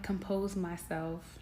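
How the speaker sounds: ordinary delivery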